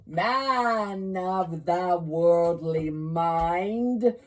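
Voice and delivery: very slow, loud hollow ghost voice; ghostly solemn. Acted. Marley's ghost addressing Scrooge, slow booming ghost. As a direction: toll each word like a bell from the grave.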